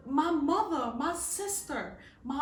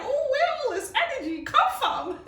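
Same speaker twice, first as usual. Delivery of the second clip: high, laughing surprise; amazed at her own energy; surprised; 12 semitones higher, 5 dB louder than usual. Retold or imitated. Puzzled at her own sudden energy, high and laughing. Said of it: "I be like".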